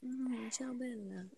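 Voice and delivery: soft mock Italian; Italian accent. Joking with friends about accents, soft mock Italian. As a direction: murmur it in a playful Italian accent. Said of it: "like, an Italian accent".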